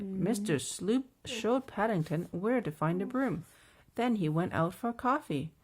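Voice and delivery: usual speaking voice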